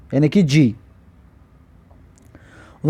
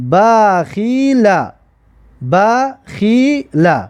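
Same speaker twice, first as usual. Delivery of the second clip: very slow, drawn-out syllables; slow teacher's model reading; much slower than usual. Shown as a demonstration. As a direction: stretch each syllable for learners to copy. Teacher models the example word.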